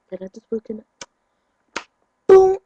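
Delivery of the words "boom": very loud, high boom; shouted boom. Retold or imitated. Acting out mom's slap, a loud shouted boom. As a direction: yell the hit out. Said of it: "mom hits him".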